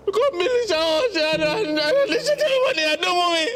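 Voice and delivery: high, tearful wail; crying out. Acted. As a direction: cry it out in despair. Son distraught over money.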